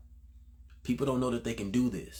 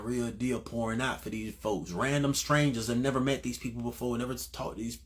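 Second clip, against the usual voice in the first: ordinary talk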